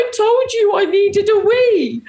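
a mock sob of protest to mum; retold crying. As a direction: whine it like a crying kid, half laughing. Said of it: "Like, crying".

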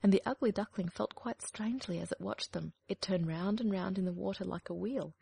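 ordinary delivery

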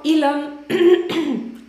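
strained, fading voice; slow, voice cracking and failing; voice giving out. Real, not acted. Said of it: "sorry, losing my voice".

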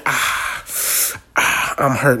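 breathy fake-hurt whine; mock whimper. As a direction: whimper like a player milking an injury. Mocking J R Smith's fake injury.

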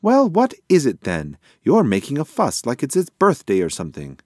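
impatient pigeon. A narrator's reading. Pigeon tires of guessing the occasion, impatient and loud.

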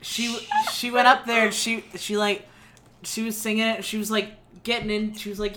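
ordinary delivery

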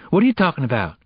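mildly snappish. A narrator's reading. Girl pressing her friend to explain, mildly snappish. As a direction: demand an answer with some impatience. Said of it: "Geneva snapped".

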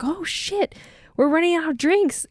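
sudden high alarmed squeak, then settling; a playful burst of fake alarm; mock panic.

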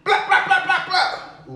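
high squeaky laughing blah-blah; mock blah-blah. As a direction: squeak nonsense through a laugh. Mocking hype noise.

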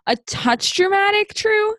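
high-pitched, mock-gentle chiding; teasing disbelief. Real, not acted. Host chiding the dramatic daughter, teasing disbelief. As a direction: lift it way up, needling the drama queen.

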